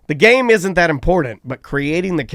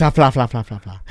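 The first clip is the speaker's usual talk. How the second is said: low comic flapping, trailing off; fla-fla-fla dropping low and fading; medium-size flapping noise; 7 semitones lower than usual. Retold or imitated. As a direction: make a floppy sound effect that sinks away. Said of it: "Medium penis".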